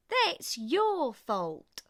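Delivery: slow and loud, each word pressed; two exhausted racers blaming each other; slow blaming retort. A narrator's reading. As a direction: spit out the blame slowly. Both blame each other.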